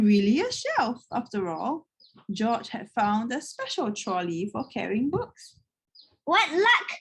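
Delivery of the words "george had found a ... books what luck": ordinary delivery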